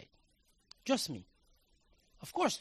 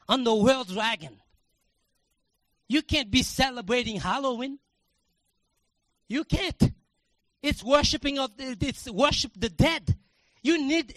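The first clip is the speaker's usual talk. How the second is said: loud, high fervent preaching shout; preaching at full force; 7 semitones higher, 9 dB louder than usual. Acted. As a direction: passionate pulpit shout with a crying edge.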